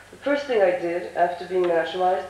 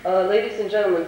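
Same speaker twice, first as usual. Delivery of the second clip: ordinary delivery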